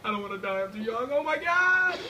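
high whiny mock sobbing, building to a shriek; fake-cry like a kid about to die; mock crying child. Gamer about to die, fake crying in a high kid voice.

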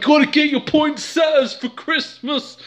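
very high-pitched, hammed-up craze; mock frenzy. Canadians frantic over Christmas plants, mock frenzy. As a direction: imitate shoppers losing their minds. Imitates holiday shoppers losing their mind.

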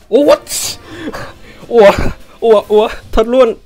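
high, loud cry of disbelief; stunned shout. Real, not acted. Streamer sees another rare pull, stunned shout. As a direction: shout like you can't believe it.

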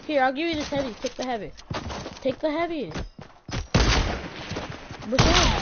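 normal talking voice

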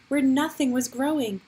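usual speaking voice

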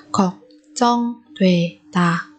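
걱정되다 slowly, each syllable apart. Shown as a demonstration. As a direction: break 걱정되다 into drawn-out syllables. Teacher models word syllable by syllable.

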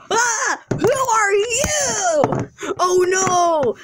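high, drawn-out cry of alarm; hammed-up startled yell; play-acted shock. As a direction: shriek at a stranger in the house. Husband finds boyfriend at home.

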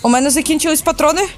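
high, rising alarm; gamer panic. Real, not acted. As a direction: panic as the bug closes in. Out of ammo, bug approaching. (панічно, високим голосом)

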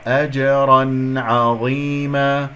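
أجرا عظيما slow, thin ayn and heavy za; drawn-out recitation of أجرا عظيما; correct light ayn. Shown as a demonstration. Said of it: "العين مرققة".

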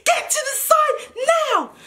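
high, strained shouted command; police shouting orders. Retold or imitated. Policeman cutting off her protest, angry high shout. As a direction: order them away, voice way up high.

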